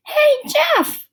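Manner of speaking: high, loud call across the street; girl yelling across the street to Jeff; shout. A narrator's reading. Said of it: "Lori shouted".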